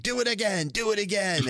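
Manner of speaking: high-pitched mock command; a hammed-up Mark urging a repeat; imitating Mark. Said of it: "and then Mark's like".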